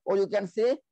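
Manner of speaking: ordinary talk, nothing marked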